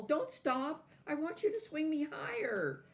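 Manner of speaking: very high, loud plea not to stop; crying out. A narrator's reading. Doll crying out to Tasha, high and loud. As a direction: wail at her to keep going. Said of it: "cried the doll".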